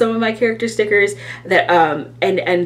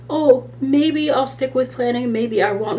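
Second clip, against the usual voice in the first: wishy-washy; 7 semitones higher than usual. Retold or imitated. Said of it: "I'm not like".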